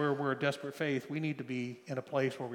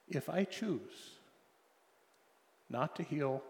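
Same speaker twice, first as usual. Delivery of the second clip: still small voice; 6 dB quieter than usual. Retold or imitated. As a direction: speak it gently and quietly, unhurried. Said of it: "a still small voice".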